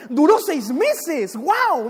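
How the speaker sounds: slow, drawn-out acted amazement; mildly hammed-up thrilled waiter; very excited. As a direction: marvel with a stretched-out wow. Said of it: "El mesero emocionadísimo".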